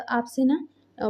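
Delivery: plain reading voice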